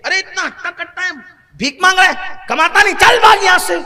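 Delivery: a rising, loud, fast "چل بھاگ یہاں سے" as a rude passerby; scornful scolding. Retold or imitated. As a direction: shoo the beggar off with contempt. Mocking beggar-scolders voiced. (حقارت بھری، بلند اور تیز ڈانٹ)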